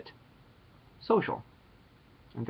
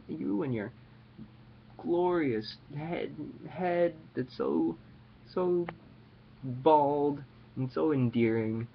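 mock adoring; 4 semitones higher, much slower than usual. Mock praise of fan's bald head.

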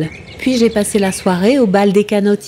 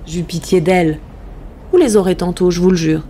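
plain reading voice

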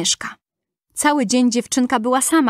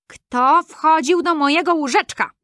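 dwarf calling out; 6 semitones higher than usual. A narrator's reading. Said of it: "zawołał".

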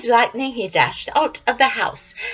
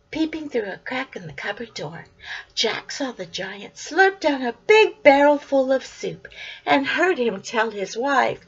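ordinary delivery

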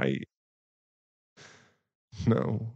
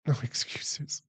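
quiet self-rebuke; 3 semitones higher, 7 dB quieter than usual. Acted.

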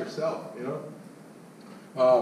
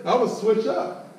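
ordinary delivery

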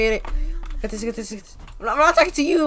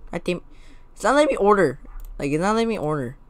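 usual speaking voice

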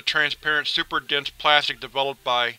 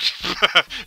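bursts out laughing; can't hold back a laugh; 4 semitones higher than usual. Real, not acted. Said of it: "I can't say it with a straight face".

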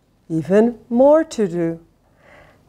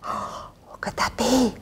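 hushed breathy whisper after a gasp; 2 semitones higher, 10 dB quieter than usual. A narrator's reading. Reading to kids, whispering at the mystery knock. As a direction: whisper it as if someone might hear. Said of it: "gasps, whispers at the door knock".

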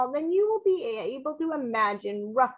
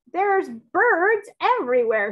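louder, higher cry of alarm; cried out; 5 semitones higher, 4 dB louder than usual. A narrator's reading. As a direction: call it out in alarm. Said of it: "he cried".

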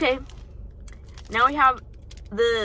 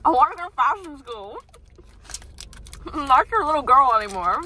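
whiny daughter; 4 semitones higher, 4 dB louder than usual. Pretend. Repeats mock daughter plea.

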